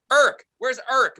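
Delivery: Irk with an ir vowel, called loud and slow; family calling for Irk across the house. Retold or imitated. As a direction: call Irk like a mom yelling for her kid. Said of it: "mother would always go".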